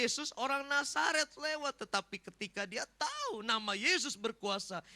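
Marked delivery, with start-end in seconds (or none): 0.0-1.8 high-pitched acted announcement; raised voice of an onlooker; bystanders' announcement. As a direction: spread the news through the crowd. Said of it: "Orang lain bilang ini".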